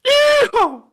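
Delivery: very high, loud cowboy whoop; requested yeehaw. Pretend. Host obliges a viewer who asked for it, whooping yeehaw. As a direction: let out a piercing yeehaw. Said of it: "since Beer Monster asked for it".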